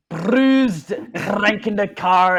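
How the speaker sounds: high, loud, slow Scottish accent demo; a Scottish accent performed on request, slow and loud. Shown as a demonstration. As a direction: put on the Scottish accent, drawn out and projected. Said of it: "can you give us a rendition of your Scottish accent?".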